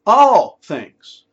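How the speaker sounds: "All" punched high and loud, then falling; stress on ALL. Real, not acted. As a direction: hit "All" hard like a preacher. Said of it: "Not some things".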